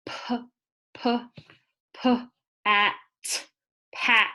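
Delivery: p repeated, then at, then blended into pat; sounding out. Shown as a demonstration. Teacher sounding out pat for kindergartners. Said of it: "get my mouth ready and start with that".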